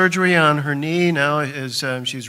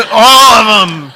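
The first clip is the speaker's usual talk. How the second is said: laughing shout; 6 semitones higher, 17 dB louder than usual. Real, not acted. Laughing call at gathering.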